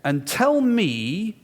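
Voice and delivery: high, very drawn-out indignant retort; mock outrage, hugely slowed; indignant challenge. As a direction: imitate an outraged heckler, stretching each word. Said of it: "absolutely determined".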